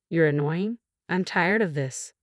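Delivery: usual speaking voice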